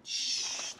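a long unvoiced shh to quiet the room; teacher shushing noisy students. Real, not acted. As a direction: hush the class with a steady shh.

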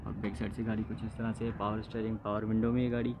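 ordinary talk, nothing marked

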